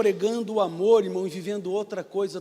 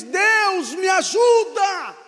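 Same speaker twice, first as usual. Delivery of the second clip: higher, louder, dragged-out shout to God; desperate cry for help; 9 semitones higher, 7 dB louder, much slower than usual. Acted. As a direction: cry out into the dark, stretching the plea. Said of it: "ele dá um grito naquela escuridão".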